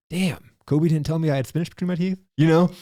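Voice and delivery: high, put-on voice of an annoyed friend; mock annoyed friend. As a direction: squeak it out like a friend who just noticed. Imagined friend discovering spinach.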